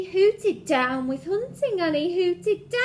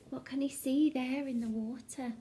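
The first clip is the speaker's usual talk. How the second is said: ordinary delivery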